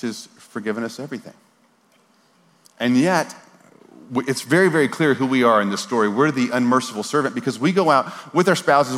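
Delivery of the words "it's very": usual speaking voice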